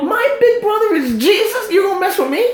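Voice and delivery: very high-pitched, comic challenge; mock tough talk. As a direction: squeal out playful threats. Boasts like a protected kid.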